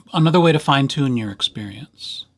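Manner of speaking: ordinary talk, nothing marked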